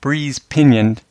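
"breeze pinioned," slow, first two syllables both stressed; slow spondee on "breeze pinioned,". Shown as a demonstration. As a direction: stress "breeze" and "pin-" back to back. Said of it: "the seventh and eighth syllables".

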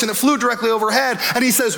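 ordinary delivery

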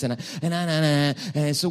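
ordinary delivery

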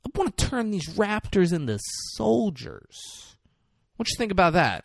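high-pitched mock schemer; villain's proposal. Acted villain's raptor pitch.

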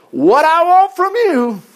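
high, loud, drawn-out declaration; God's emphatic demand. Acted. Reads God's demand with force.

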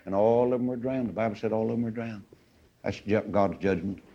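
hushed solemnity. Real, not acted. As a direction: drop to a soft, grave hush. Solemn aftermath of the drowning.

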